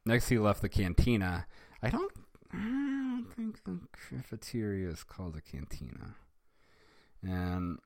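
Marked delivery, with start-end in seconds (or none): none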